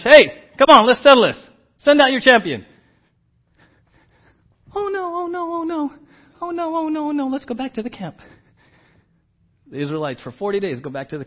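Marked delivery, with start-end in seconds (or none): 0.0-2.6 loud, high giant's taunt; Goliath taunting. Retold or imitated. Goliath's challenge voiced again. 4.7-8.2 high, whiny mock panic. Frightened Israelites voiced mockingly.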